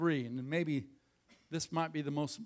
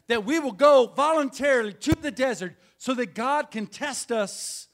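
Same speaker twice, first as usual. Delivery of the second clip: louder, higher, driving the point home; rising to a full preaching push; preacher swelling from plain talk into a loud call; building preach; 5 semitones higher, 9 dB louder than usual. Acted. Repeats proposal, building to preaching.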